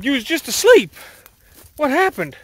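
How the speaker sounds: high-pitched, loud bewildered parent; mock incredulous. Dad to kids who were just nodding off, mock incredulous. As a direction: exclaim in acted disbelief.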